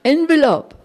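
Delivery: high calls, climbing on each Envelope; the guru calling Envelope as he walks off, high and loud; repeated loud call. Retold or imitated. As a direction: call it out again and again across the hall. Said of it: "rief er immer wieder".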